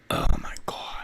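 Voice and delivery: breathless whispered oh my God; whispered exasperation. Real, not acted. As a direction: whisper it to yourself, fed up. Exasperated by stream glitch.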